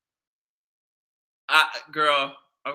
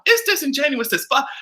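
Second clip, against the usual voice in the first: ordinary delivery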